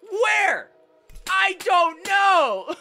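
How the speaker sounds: high, loud cry of bafflement; hammed-up, high-pitched bewildered yell; baffled shouting. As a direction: shout it, dragging out the words. Mock outburst over mystery gauntlets.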